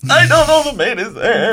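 high, slow, drawn-out shout; comic shouted interjection. Acted. Player jumping in about the maid, high drawn-out shout. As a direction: stretch it out in a high silly holler.

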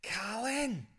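high, drawn-out mock call; smecked-out spide. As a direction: call over like a wasted street lad. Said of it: "he's like".

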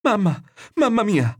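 put-on high-pitched frightened child's wail; shrieking for mother. A narrator's reading. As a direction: cry out for mum in terror. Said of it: "cominciò a strillare".

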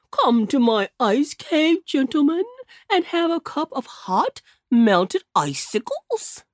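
lightly voiced, slow jolly bear; jolly polar bear. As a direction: invite them in warmly and slowly. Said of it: "in his jolly voice".

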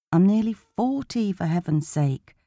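usual speaking voice

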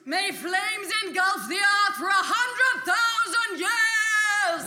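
loud very slow apocalyptic curse; villain's doom curse. Acted. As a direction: call down fire on the world.

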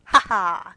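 loud stage "Ha ha"; hammed-up villain laugh; wild villain laugh. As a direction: cackle like a dying villain. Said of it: "with a wild".

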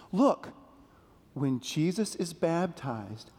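ordinary delivery

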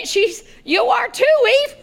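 loud, high mock shock; startled retort. Adam answering Eve's discovery, acted loud surprise. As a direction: throw it back high and loud.

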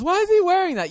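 put-on a girl asking, puzzled; girl's question. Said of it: "And if the girl's".

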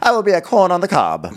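playful grand-lady voice; mock royal vow. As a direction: declare it like a pompous heroine. Mock character line, joking.

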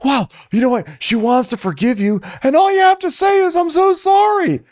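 high, slow mock whine of Shep. Shep scolding the women on the bus, high mock whine. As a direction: whine it up high like a wounded guy. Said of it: "he's like".

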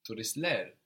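"Turistler" with the accent on LER; stress on final syllable. Shown as a demonstration. Teacher modelling the plural, "Turistler" stressed on the last syllable. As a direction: put the weight on the ending of "Turistler". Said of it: "having the accent on the plural ending".

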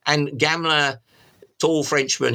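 normal talking voice

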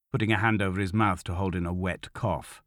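plain reading voice

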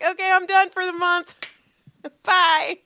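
high, giddy quote breaking into a laugh; playful high-pitched farewell; mock gleeful sign-off. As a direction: announce it high and gleeful, then toss off the goodbye. Imagined gleeful quitting for the month.

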